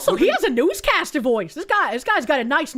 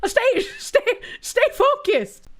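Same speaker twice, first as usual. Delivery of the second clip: laughing scold; 10 semitones higher, slower than usual. Acted.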